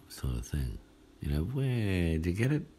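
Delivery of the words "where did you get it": high, sing-song ad catchphrase; old telly ad voice. Retold or imitated. Preacher mimicking an old telly ad, sing-song. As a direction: lift it into a cheeky jingle. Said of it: "There used to be an ad".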